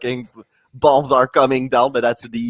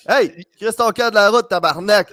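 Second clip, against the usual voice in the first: mock Québécois road rage, pitched up; swearing French driver; 6 semitones higher than usual. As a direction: curse in French like an impatient Montreal driver. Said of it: "basically, it was in French".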